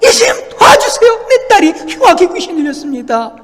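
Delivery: high, loud begging cry; desperate pleading. Acted. Woman kneeling before Jesus for her daughter, desperate pleading. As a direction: implore at the top of your voice. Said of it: "무릎 꿇고 간청을 하는".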